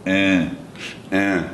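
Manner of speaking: "Eh" said through the nose; plugged-nose nasal. Shown as a demonstration. Teacher showing how to say yes, nasal. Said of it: "Okay, plug your nose".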